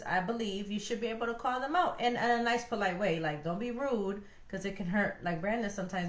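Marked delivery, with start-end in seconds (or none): none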